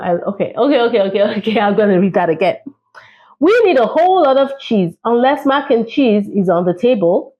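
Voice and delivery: plain reading voice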